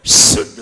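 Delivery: शुद्ध with a loud, drawn-out hissing s; शुद्ध launched on a forceful long sibilant; whistling sibilant. Shown as a demonstration. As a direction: let the first sound of शुद्ध whistle hard. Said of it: "अगर सीटी नहीं आती है".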